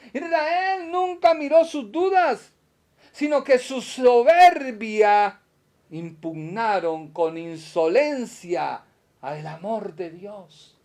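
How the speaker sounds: loud, high, slow preaching climax; preaching at full force. Acted. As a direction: thunder it out slowly, word by word.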